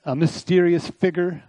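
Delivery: lightly imitated British accent; mild mock-British delivery; Alistair Begg. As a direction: quote a preacher with a hint of British accent. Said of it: "I love his accent".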